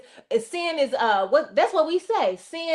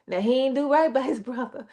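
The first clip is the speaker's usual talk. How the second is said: usual speaking voice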